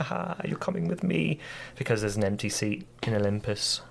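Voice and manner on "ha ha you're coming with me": higher, teasing sing-song claim; a smug god snatching a new recruit; gloating god. Retold or imitated. Athena taking Kratos to Olympus, mock gloating. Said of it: "he's like".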